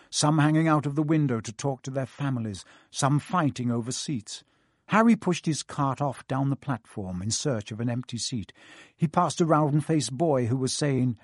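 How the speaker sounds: ordinary delivery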